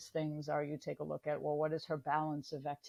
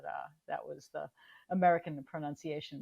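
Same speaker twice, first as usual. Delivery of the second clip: usual speaking voice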